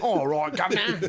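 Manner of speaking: put-on Birmingham accent. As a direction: ham up a Birmingham girl's accent. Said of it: "Like, that accent".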